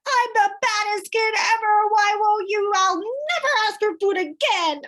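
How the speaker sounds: put-on high, loud, whiny child shrieking; daughter's tantrum voice. Mother imitating her daughter's meltdown over chocolate, whiny and shrill. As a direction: play the furious four-year-old refusing everything. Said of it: "And what did she do?".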